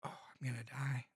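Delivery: hushed dread before a bite; whispering. Real, not acted. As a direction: whisper it to yourself. Dreading the hot sauce.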